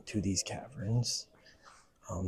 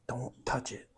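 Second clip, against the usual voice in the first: ordinary talk, nothing marked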